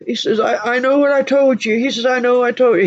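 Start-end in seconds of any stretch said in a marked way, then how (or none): 0.4-1.8 high, pleading retelling; imitating sobbing man. Michael owning up to his promise, imitated sobbing. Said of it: "He just cried and he's sobbing". 2.0-2.8 high, pleading retelling; imitating sobbing man. Michael owning up to his promise, imitated sobbing. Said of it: "He just cried and he's sobbing".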